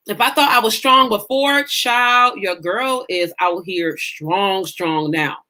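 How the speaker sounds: normal talking voice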